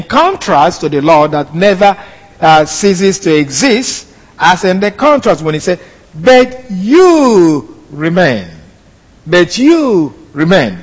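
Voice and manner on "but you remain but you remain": "you" drawn out long, then a pause; emphatic YOU. Shown as a demonstration. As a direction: slowly lean hard on "you" before "remain". This is an emphatic assertion.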